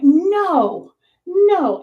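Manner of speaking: high, loud, drawn-out no; emphatic refusal. Retold or imitated. Storyteller replaying her refusal to her friend, emphatic.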